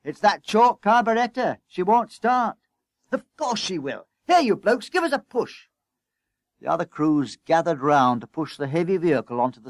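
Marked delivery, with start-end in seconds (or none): 0.0-2.6 loud, high-pitched complaint; exasperated, raised voice; shouting. A narrator's reading. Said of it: "he shouted". 3.1-5.7 high, insistent orders; harassed, high-pitched boss; urgent calling out. A narrator's reading.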